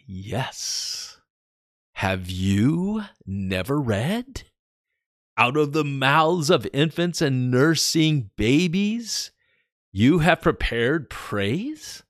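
slow, then rising, firm reply; Jesus answering. Acted. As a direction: answer calmly but with authority. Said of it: "So I'm gonna read that again".

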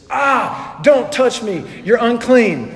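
high, loud playful shriek of disgust; wife's mock yell. Wife pushing off her grass-covered husband, loud mock yell. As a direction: recoil loudly from the sweaty hug. Wife recoiling from sweaty hug.

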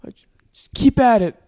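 high patronizing mock voice; mock pitying encouragement; condescending stranger. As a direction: imitate a smug guy consoling you. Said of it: "He's like".

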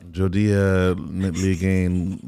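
slow Creole phrase; speaking Haitian Creole. Shown as a demonstration. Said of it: "just tell me that the sun was out".

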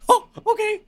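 very high, loud squeaky cartoon mouse; Mickey Mouse voice. Hosting the party as a cartoon mouse, squeaky high voice.

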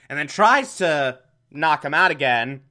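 usual speaking voice

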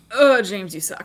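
loud mock scolding. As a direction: scold James theatrically. Scolding long-dead man.

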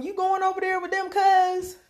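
high, lightly drawled country question; Southern drawl. Retold or imitated. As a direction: play the rural cousin asking. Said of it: "Talking about some".

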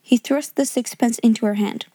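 usual speaking voice

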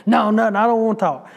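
mock angry boy, a bit forceful; retold anger. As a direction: imitate a boy firmly refusing to talk. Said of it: "so angry".

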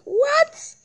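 squeaky high incredulous what; mock shock at having to buy water. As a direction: shoot the word way up in disbelief. Disbelief at buying water.

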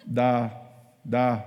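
low, isolated syllables with long gaps; half-hearted attempt. Self-conscious beginner attempting tongues, half-hearted. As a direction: try it reluctantly, one syllable at a time.

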